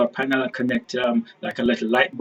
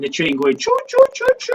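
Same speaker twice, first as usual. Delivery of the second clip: train whistle; 4 dB louder than usual. Pretend. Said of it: "imitating a train".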